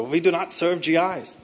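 lightly put-on German accent, measured pace; mock German accent. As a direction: imitate a German refusing service, drawn out. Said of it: "he said in his German accent".

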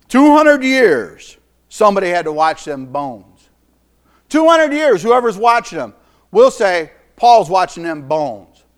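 full-force preaching. Acted. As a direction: preach it out to the whole congregation.

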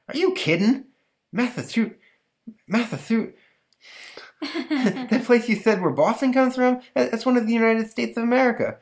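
put-on very high, laughing kid voice; boy can't believe the name; incredulous boy. Stanley laughing at the monster's name, very high. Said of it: "Stanley laughing disbelief".

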